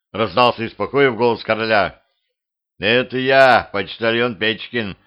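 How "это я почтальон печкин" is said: ordinary delivery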